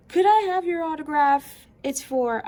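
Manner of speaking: high, loud fan voice; high excited planet character; Jupiter asking for autograph. Acted. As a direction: play the starstruck planet.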